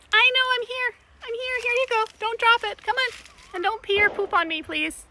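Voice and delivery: high, loud sing-song coaxing; coaxing monkeys. Real, not acted. Feeding monkeys a banana, high loud coaxing.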